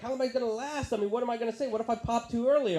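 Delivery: high, mock-anxious questions; panicky questioning. Acts panic to experienced friend.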